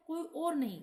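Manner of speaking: usual speaking voice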